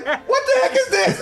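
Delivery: wife finds a wafer in her purse, shocked and high; mock shocked Candace. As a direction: squeal it, shocked. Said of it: "Candace's like".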